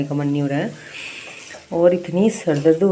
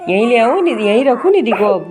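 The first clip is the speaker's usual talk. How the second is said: louder call across the house; louder call to fetch Nidhi's things; 4 semitones higher, 7 dB louder than usual. Real, not acted. Calling out to bring Nidhi.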